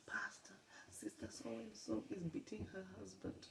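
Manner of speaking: high whispered imitation of the girl; whispering girl. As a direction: whisper it like a secret phone call. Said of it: "She's speaking to my pastor".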